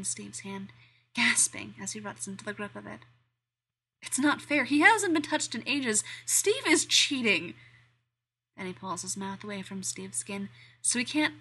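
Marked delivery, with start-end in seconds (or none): 4.0-7.5 high, loud, desperate complaint; almost whining it out; frantic protest. A narrator's reading. Danny's frantic inner protest.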